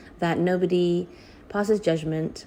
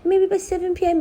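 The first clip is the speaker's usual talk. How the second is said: gleeful cheer; 12 semitones higher, 5 dB louder than usual. Retold or imitated. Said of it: "he messaged everybody".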